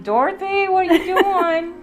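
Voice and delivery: calling loudly from another room; shouted call across the house; yelling. Retold or imitated. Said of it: "He'll yell from the other room".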